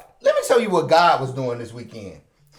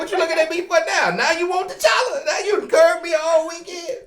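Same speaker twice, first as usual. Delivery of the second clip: indignant shout; 14 semitones higher, 5 dB louder than usual. Retold or imitated. Acting his indignant airport thoughts.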